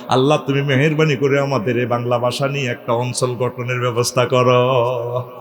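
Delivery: low, level chant-like sermon voice; intoned preaching. Real, not acted. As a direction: intone it low as a plea to God, drawing out phrase endings. Intoned preaching turning to prayer. (নিচু একটানা সুরে মিনতির ওয়াজ)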